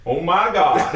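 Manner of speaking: high, laughing Southern American oh my God; a laughing Southern American exclamation; southern American drawl. Retold or imitated. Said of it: "this southern guy comes in".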